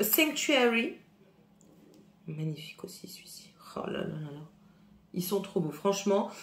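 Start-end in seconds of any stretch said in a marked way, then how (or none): 2.3-4.5 hushed, breathy admiration; whisper. Real, not acted. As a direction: whisper it like a secret treasure.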